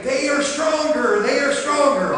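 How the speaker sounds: high, taunting; lightly voiced bully fear. Pastor playing fear in the tug of war, high taunt. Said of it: "and I'll say".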